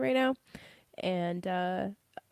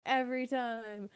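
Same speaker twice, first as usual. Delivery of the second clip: normal talking voice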